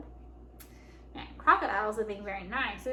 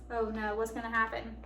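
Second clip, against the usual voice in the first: plain reading voice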